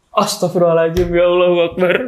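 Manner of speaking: high, alarmed cry to God; startled invocation. Retold or imitated. Man re-enacting his shock at the scream, alarmed.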